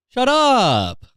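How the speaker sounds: high, drawn-out yell; hammed-up impatient holler; exasperated dismissal. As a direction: cut them off with an exasperated cry. Ranting at complaining victims.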